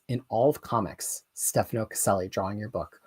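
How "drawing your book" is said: ordinary talk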